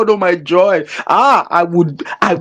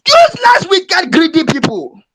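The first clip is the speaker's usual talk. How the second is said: furious shout; 7 semitones higher than usual. Real, not acted. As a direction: explode on the word useless. Angry outburst at churches.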